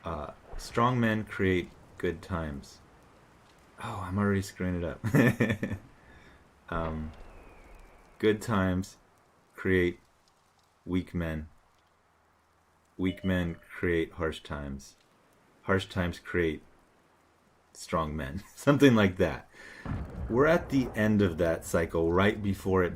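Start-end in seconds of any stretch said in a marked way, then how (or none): 0.6-2.8 reciting slowly so as not to slip; slow and careful. Real, not acted. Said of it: "if I say it slowly". 8.2-18.4 halting, with pauses between phrases; slow, careful recital. Real, not acted. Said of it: "if I say it slowly".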